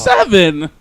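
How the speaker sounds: loud, high disbelief; incredulous. Real, not acted. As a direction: blurt it out in disbelief.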